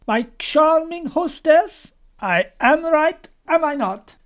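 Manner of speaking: high, loud and drawn-out exclamation; exclamação teatral. A narrator's reading. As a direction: exclaim it grandly, stretching the words. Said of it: "and exclaiming".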